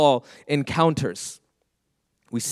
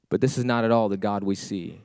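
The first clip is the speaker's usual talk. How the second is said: normal talking voice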